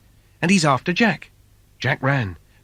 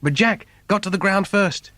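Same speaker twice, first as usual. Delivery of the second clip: ordinary delivery, nothing marked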